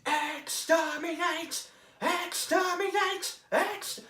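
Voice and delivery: slow, high, loud Dalek cry; Dalek exterminate. Shown as a demonstration. Demoing how to build into the Dalek voice, slow high Dalek cry. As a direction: stretch it out like a Dalek. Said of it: "So, like".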